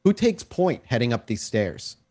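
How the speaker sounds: ordinary talk, nothing marked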